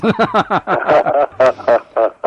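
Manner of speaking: loud, high belly laugh; long burst of hearty laughter. Real, not acted. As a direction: crack up at your own impression. Laughs at own preacher impression.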